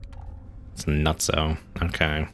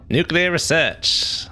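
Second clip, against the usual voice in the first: "Nuclear research" drawn out slowly and much higher, like a trailer narrator; movie trailer voice; 12 semitones higher, much slower than usual. Shown as a demonstration. Streamer hamming up his stream title, slow grand announcer.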